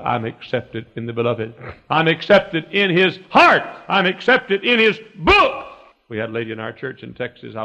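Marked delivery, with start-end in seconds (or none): 1.9-5.7 high, slow, loud proclamation; emphatic preaching. Real, not acted. Preacher proclaims his acceptance to the church, emphatic preaching. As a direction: punch it out slowly, pitched up high.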